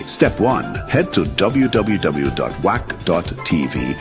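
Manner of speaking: usual speaking voice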